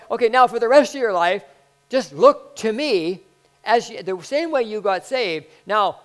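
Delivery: loud, high preaching build; preaching at full force. Acted. Preacher pressing the point to the church, loud and high. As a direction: drive it home to the congregation.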